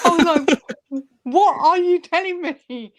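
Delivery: high, loud laughing disbelief; mock shocked laugh. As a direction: replay the shocked reaction, half laughing. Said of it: "I'm going".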